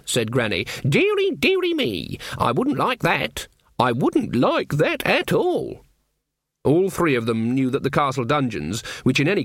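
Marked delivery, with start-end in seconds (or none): 0.9-5.9 high, slow mock dread; sarcastic old witch. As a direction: feign alarm in a high witchy voice. Voices Granny, mock dread.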